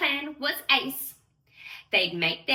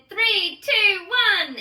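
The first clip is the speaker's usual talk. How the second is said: loud, high countdown shout; hollered countdown; 7 semitones higher, 6 dB louder, slower than usual. A narrator's reading. Linda hollers the countdown to the fairies, loud and high. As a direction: call it out to the launch crowd. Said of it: "Linda hollered".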